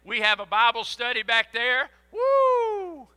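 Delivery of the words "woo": high excited church whoop; joyful whoop. Real, not acted. Preacher whooping at the congregation in delight. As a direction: let out a delighted holler.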